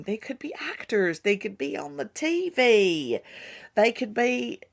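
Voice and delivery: put-on a light Southern country accent on "They could be on the T V". Teasing the brothers' acting dreams in a light country accent. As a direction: drawl it out a little, country style. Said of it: "let's not break out my country accent".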